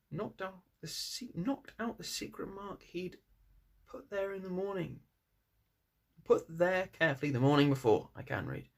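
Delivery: ordinary delivery, nothing marked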